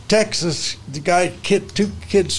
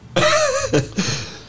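squeaky burst of laughter; high giggle. Real, not acted. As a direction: laugh at the story. Laughs at the story.

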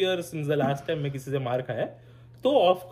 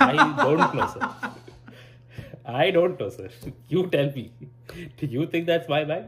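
playing dumb with a laugh, teasing the stranger; sarcastic feigned ignorance with a laugh; cheeky mock innocence. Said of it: "let's see if you have a sense of humor today".

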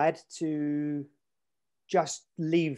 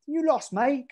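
English stranger voice; 10 semitones higher, slower than usual. Retold or imitated. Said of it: "in an accent very similar to mine".

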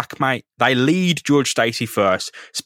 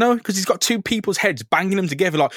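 ordinary delivery

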